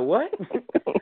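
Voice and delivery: can't believe what he just heard; incredulous, pitch leaping up; stunned what. Retold or imitated. Stunned by request for steak.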